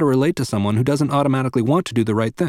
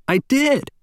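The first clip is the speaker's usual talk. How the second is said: much higher, bright and proud little voice; proud beaming child; 13 semitones higher than usual. Retold or imitated. Daughter proudly tells mom she did it, high and beaming. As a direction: say it like a beaming four-year-old. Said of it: "She was beaming".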